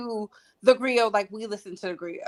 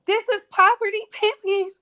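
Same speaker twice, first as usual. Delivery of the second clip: louder, higher, driving it home; emphatic retold outburst; 8 semitones higher, 4 dB louder than usual. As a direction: hammer the phrase twice with heat. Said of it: "I said".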